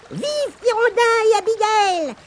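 acclamation de fourmis très aiguë; crier en chœur. Acted. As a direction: lancer un hourra de fourmis. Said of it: "crièrent les fourmis". (les fourmis acclament leurs sauveurs, cri très aigu)

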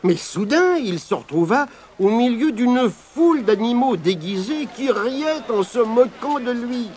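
plain reading voice

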